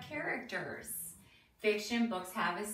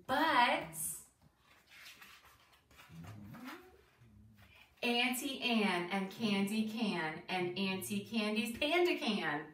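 lilting, deliberate rhyme reading; reading to children. Acted. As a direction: read a bouncy rhyme to young listeners, questions lifted at the end. Reads picture book to children.

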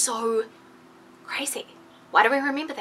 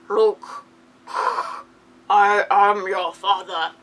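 slow, heavy villain reveal; dramatic Darth Vader; much slower than usual. Retold or imitated. Vlogger acting the famous Vader reveal, slow and heavy. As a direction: drag it out like a movie villain. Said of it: "but it's more like".

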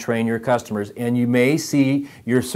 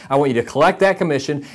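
normal talking voice

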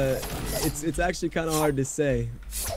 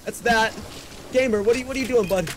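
still wound up; 8 semitones higher, faster than usual. Real, not acted. Aftermath of fight, still wound up.

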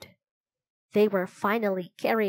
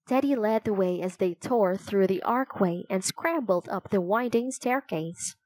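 plain reading voice

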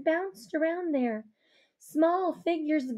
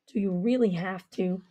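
low male voice; 8 semitones lower than usual. Acted. Avery asks.

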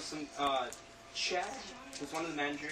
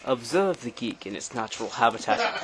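nature documentary; 3 semitones lower, 12 dB louder than usual. Pretend. Mock narration filming his friend.

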